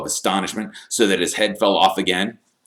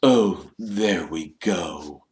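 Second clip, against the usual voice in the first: slow, resigned ghostly grumble; bitter Nearly Headless Nick; much slower than usual. A narrator's reading. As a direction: drag the words out bitterly. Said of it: "Nearly Headless Nick bitterly".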